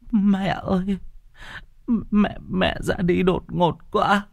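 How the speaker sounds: daughter-in-law keening over the dead mother, high and tearful; tearful lament. Acted. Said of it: "bật khóc".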